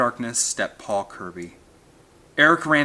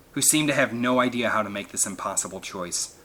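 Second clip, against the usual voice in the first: ordinary delivery, nothing marked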